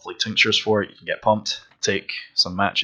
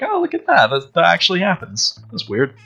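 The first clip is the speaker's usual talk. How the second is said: mock amazement; 8 semitones higher than usual. Quoting an amazed onlooker's thought.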